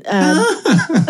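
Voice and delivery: high delighted laughter; big laugh. Real, not acted. Guest cracking up at being called a goth.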